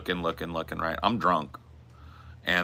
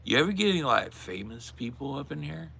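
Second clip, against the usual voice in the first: put-on drunk curious ask; 7 semitones higher, 3 dB quieter than usual. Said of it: "I'm just like".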